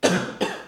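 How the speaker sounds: sudden choking cough; coughing up water. Real, not acted. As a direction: cough out water gone down wrong. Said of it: "You okay?".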